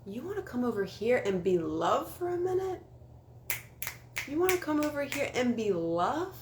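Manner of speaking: high, sugary mock-sweet voice; sassy sweetie brush-off. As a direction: sweetly show them the door. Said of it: "I say".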